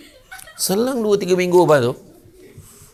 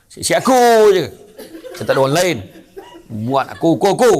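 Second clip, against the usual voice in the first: geram; 4 semitones higher, 6 dB louder than usual. Acted. Pekerja melepaskan geram.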